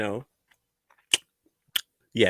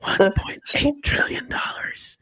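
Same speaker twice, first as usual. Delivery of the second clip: whispering; 11 semitones higher, 5 dB quieter than usual. Pretend. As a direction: breathe it out gleefully. Whisper bit.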